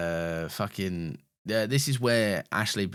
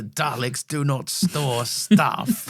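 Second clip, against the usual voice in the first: very slow robotic Dalek with a laugh; 3 semitones higher, 4 dB louder, much slower than usual. Retold or imitated. Co-host quotes the Dalek's best line, very slow robotic. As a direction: drag out each word like a machine, laughing. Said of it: "it has the best line".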